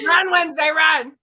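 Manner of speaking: loud, laughing cry of run; laughing shout. Acted. Joking to cohosts, shouting through laughs.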